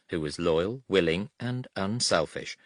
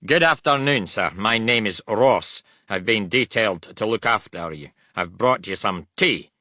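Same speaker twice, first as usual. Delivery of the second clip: put-on loud Scots accent; strong Scots accent; 3 semitones higher, 6 dB louder than usual. A narrator's reading. Young orderly introduces himself to officer, strong Scots accent. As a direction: report for duty in broad Scots. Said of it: "said in strong Scots accent".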